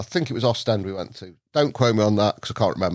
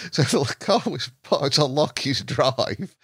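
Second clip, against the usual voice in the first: ordinary talk, nothing marked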